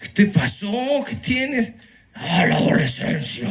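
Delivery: a hint of a grumbling teen voice; mock the mumbling adolescent; sulky teenager. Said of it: "Empiezan a hablar así". (adolescente malhumorado, apenas imitado)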